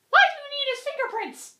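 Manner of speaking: high-pitched Catwoman question. Retold or imitated. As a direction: voice her light and high. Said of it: "Catwoman is like".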